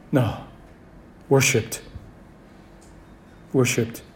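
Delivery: low, soft worshipped; hushed correction. Real, not acted. Preacher softly correcting the congregation's guess, hushed. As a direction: whisper the true word.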